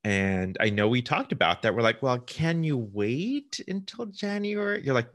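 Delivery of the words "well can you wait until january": retold plea, high and slow; a hint of a pleading voice, drawn out; mock pleading. Quoting a plea to the sellers.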